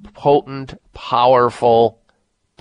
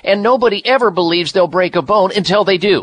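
radio ad read; 7 semitones higher than usual. Acted. Reads bone supplement ad.

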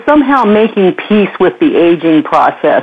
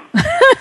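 high giggling burst; burst of high laughter. Real, not acted. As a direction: let a high laugh escape. After her declaration.

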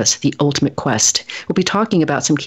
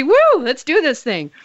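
high, bright cheer; excited; 10 semitones higher than usual. Real, not acted. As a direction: pump up the energy. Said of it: "A little energy".

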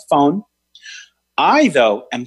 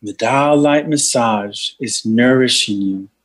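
meditation guide voice; guided meditation. Acted. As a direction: lead a healing meditation. Leads a healing meditation.

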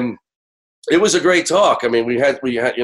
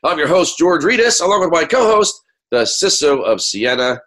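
normal talking voice

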